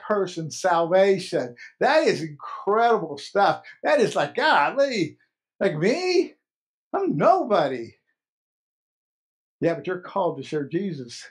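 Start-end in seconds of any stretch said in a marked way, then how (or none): 4.3-7.9 high-pitched, swooping disbelief; mock incredulous. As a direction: act out a humble "who, me?". Voicing a humbled, disbelieving believer.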